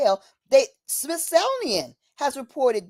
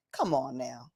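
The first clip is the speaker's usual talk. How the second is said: dismissive come on; 10 semitones lower, 10 dB quieter than usual. Real, not acted. Soft dismissive aside.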